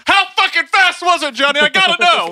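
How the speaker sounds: hammed-up desperate curiosity. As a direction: beg for the answer in mock desperation. Hammed-up prompt on request.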